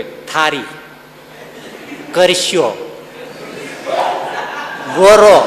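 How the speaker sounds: ગોરો with r where l belongs; regional r for l. Retold or imitated. Mocking villagers who say ગોરો for ગોળો, laughing. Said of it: "અળ ન બોલી શકે".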